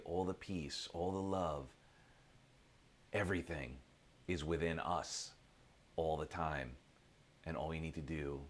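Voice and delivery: soft, low, spaced-out phrases; reflective meditation. Real, not acted. Speaker reminds listeners happiness is within, hushed and reflective. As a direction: sink gradually into hushed, soothing sincerity.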